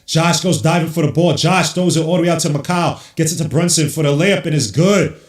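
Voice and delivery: high, loud, rising play-by-play shout; excited call. Real, not acted. Streamer calling Josh Hart's diving hustle play, shouting. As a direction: call it like the crowd's on its feet.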